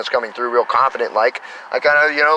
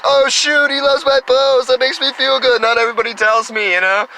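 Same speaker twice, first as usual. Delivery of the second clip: excited reaction; 10 semitones higher than usual. Retold or imitated. His own excited reaction.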